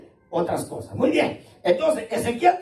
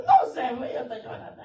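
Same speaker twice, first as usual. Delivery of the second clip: mock tearful; 2 semitones higher than usual. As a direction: play a crybaby who can't explain the tears. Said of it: "¿Por qué estás llorando?".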